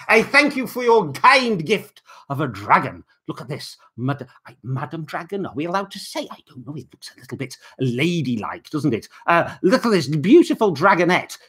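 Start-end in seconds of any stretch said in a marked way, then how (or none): none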